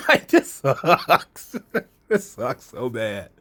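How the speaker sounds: laughing groan. Real, not acted. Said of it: "laughing in dismay".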